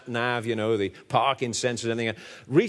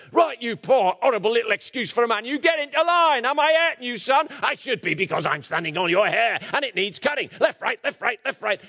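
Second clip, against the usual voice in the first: higher, louder parade-ground bark; bellowing sergeant major; 10 semitones higher, 3 dB louder than usual. Retold or imitated. Preacher impersonating a sergeant major shouting at a recruit. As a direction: roar at the recruit like a drill sergeant. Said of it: "It's the Sergeant Major".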